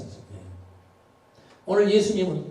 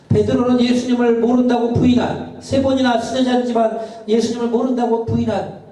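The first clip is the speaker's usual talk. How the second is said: fervent preaching; 9 semitones higher, 4 dB louder than usual. Acted. Preaching emphasis.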